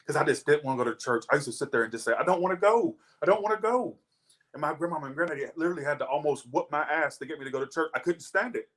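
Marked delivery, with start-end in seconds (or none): 2.1-4.0 put-on childish whine. Child refusing church.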